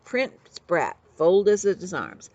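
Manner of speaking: plain reading voice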